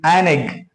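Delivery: "an egg" said as one word, "an" flowing into "egg"; article demo. Shown as a demonstration. Teacher shows how "an egg" sounds, linked. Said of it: "pronounce the word egg".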